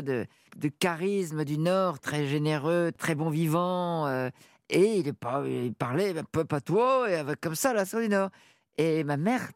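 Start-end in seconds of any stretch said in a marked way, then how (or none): none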